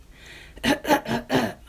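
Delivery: a loud fatherly cough; throat-clearing cough. Acted. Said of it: "Papa Pumpkin coughed".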